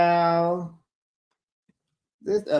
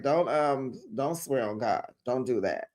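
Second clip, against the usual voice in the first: gently scold, voice dropped right down; hushed little telling-off; soft admonishment; 2 semitones lower, 7 dB quieter than usual. Acted. Acted calm scolding after outburst.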